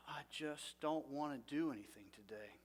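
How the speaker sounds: soft, heavy, defeated inner voice; quiet despairing thought. Retold or imitated. Preacher voicing a depressed morning thought, soft and defeated. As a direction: mutter it like you can't face the day.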